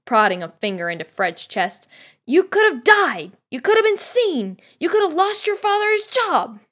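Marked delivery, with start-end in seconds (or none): 2.2-6.6 high, loud mother's rant; scolding at the top of her voice; yelling. A narrator's reading. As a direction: pile up the accusations at a yell. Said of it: "yelled Missus Weasley".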